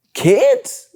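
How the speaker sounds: loud, high-pitched disbelief; incredulous exclamation. Real, not acted. Uncle reacting to how wild kids are, incredulous exclamation. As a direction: voice leaps up on a questioning outburst.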